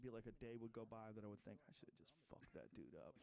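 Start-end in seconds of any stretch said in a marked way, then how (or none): 1.7-3.1 low, soft, unvoiced inner voice; whispered thought. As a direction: whisper it to yourself. Retold regretful thought.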